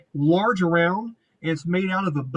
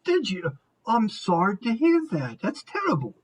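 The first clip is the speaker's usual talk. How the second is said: syrupy "poor you" delivery; put-on sympathy; 4 semitones higher than usual. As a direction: pile on the sympathy for a loser. Woman's sympathy to losing player.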